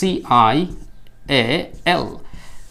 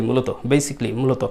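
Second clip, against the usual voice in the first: ordinary talk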